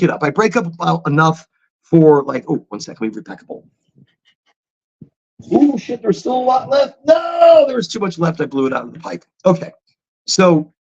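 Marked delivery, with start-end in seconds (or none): 2.2-3.7 muttered aside. Real, not acted. Aside while repacking pipe. 5.5-8.4 sudden high surprised yelp; surprised outburst. Real, not acted. Finding the pipe still packed, a surprised shout.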